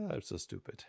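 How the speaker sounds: breathy self-mocking whisper; whispered aside. Real, not acted. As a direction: whisper it to yourself.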